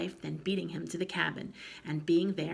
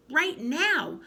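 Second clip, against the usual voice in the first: louder, higher snap; sharp demand; 10 semitones higher, 7 dB louder than usual. A narrator's reading. Grandma pressing the boy to come at once. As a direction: bark it as a demand.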